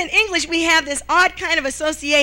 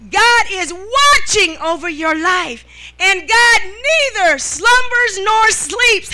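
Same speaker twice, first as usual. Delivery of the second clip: slow, louder and higher preaching build; full preaching; 4 semitones higher, 5 dB louder, slower than usual. Acted. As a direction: lift it to the pulpit climax, slowly. Preaching climax to congregation.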